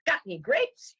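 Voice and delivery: high, perky little duck voice; duck in a joke. Acted. Duck asking the bartender, high and innocent. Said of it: "He says".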